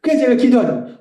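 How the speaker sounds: ordinary talk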